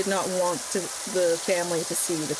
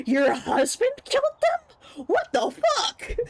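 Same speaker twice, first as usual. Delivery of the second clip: much higher, laughing outrage; shocked disbelief; slower than usual. Retold or imitated. Parents hearing the husband killed the kids, squealing in shock. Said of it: "And they're like".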